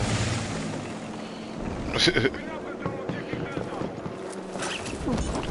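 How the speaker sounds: high distant villain cackle; evil laugh from far off. Acted. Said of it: "That was a good villain voice".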